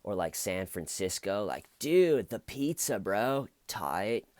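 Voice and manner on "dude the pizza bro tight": laid-back surfer dude, high start; "Dude, bro" in a lazy Valley drawl; Valley accent. Retold or imitated. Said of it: "he talks like a Valley girl".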